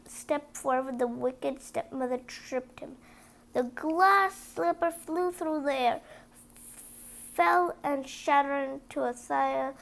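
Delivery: ordinary delivery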